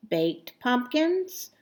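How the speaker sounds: usual speaking voice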